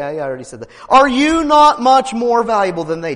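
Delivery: high, loud preaching; fiery preaching. Acted. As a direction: preach it out to the congregation. Preaching at full force.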